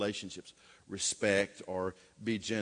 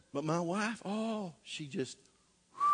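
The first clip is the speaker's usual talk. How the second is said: ordinary delivery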